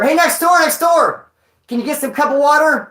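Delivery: loud, high call to the next barracks. Retold or imitated. Inmates calling over to the next barracks, loud high call. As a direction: shout it across to the neighbours.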